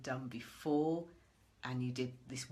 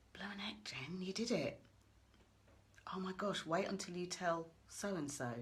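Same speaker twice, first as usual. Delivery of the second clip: quiet inner voice; 4 semitones higher than usual. Pretend. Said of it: "it'll be quite a quiet voice".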